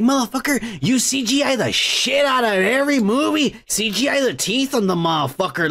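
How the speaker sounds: usual speaking voice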